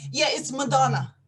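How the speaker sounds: ordinary talk, nothing marked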